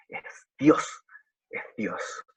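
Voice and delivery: a reverent, awed whisper; hushed whisper. Acted. Preacher to congregation on the cross, whispered. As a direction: breathe it out in an unvoiced whisper. Preacher stressing whom Jesus calls.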